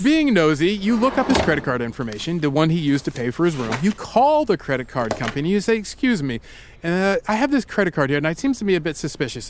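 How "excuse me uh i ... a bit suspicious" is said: hesitant, proper British phone manner; British accent. Retold or imitated. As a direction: play a prim British caller. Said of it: "but in a British accent".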